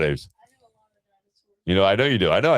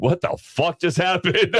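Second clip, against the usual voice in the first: high-pitched stunned outburst, slowly drawn out; mock shock, way up high; incredulous; 13 semitones higher, slower than usual. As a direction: retell it in stretched, squeaky disbelief. Retold shock at son's new voice.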